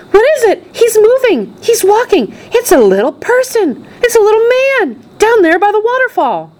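put-on very high, squealing girl's cries; screams of excitement. A narrator's reading. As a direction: shriek in astonished excitement. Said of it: "screams of excitement".

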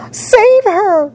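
high-pitched desperate cry for help; scream. A narrator's reading. Mother screams for her daughter's rescue, high frantic scream. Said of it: "screamed Missus Salt".

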